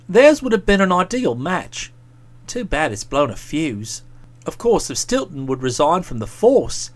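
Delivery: a hint of a pensive chum, talking low; lightly voiced musing friend.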